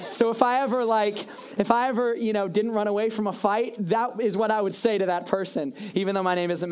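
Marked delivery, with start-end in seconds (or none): none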